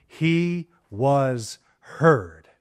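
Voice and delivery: very slow, word by word; drawn-out emphasis. Real, not acted. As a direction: let each word land separately, with weight. Preacher drives home the point.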